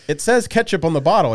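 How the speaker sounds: loud, high protest; retold exasperation. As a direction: protest as if it's written right there.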